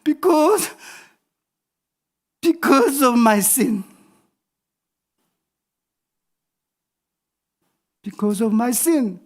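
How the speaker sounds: put-on high-pitched, very slow, halting little-kid English; imitated cornered child. Three-year-old answering dad in picked-up English, slow imitated plea. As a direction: draw each word out like a desperate small child. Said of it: "아이가 막 급박하니까".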